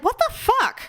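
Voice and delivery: high-pitched retold indignation; a high, incredulous outburst; retold outrage. Mother recalling her reaction to the sneeze, mock outrage.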